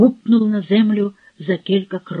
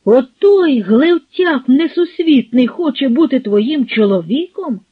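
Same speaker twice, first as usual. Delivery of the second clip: higher, louder, slow outraged question; indignant exclaim; 5 semitones higher, 4 dB louder, slower than usual. A narrator's reading. Young man hearing a rival wants his girl, indignant. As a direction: spit out his scorn for the rival. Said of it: "обурено вигукнув Яник".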